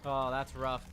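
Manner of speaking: usual speaking voice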